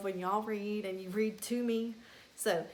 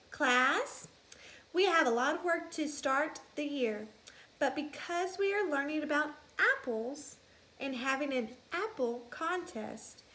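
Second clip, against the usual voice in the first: higher teacher voice; teacher announcing; 5 semitones higher than usual. Acted. Teacher mouse telling the class about the trip, higher. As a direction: address the class brightly.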